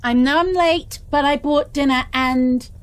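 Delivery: breezy loud explanation tossed ahead; cheerful calling out. Acted. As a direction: keep the bright called-out tone.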